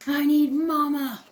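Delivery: loud, high, slow wail for Mama; whiny child. Acted. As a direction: plead like a cross little kid. Jilly wanting her mother.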